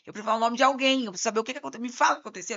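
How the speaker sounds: loud, fast pressing for answers; insistent demand. Retold or imitated. Lawyer demanding the truth from client, loud and insistent. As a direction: press an evasive client, raising the voice.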